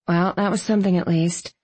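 plain reading voice

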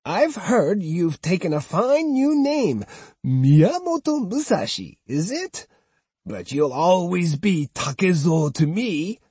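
high, mocking old woman's voice; taunting old woman. Old woman mocking the swordsman by his old name, high and sneering. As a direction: needle him like a spiteful grandmother.

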